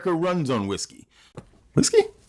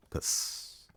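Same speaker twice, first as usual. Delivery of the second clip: very soft unvoiced whisper; whispered exit; 12 semitones lower, 15 dB quieter than usual. Real, not acted. Reactor whispering a sign-off to viewers, hushed. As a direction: whisper it as if sneaking away.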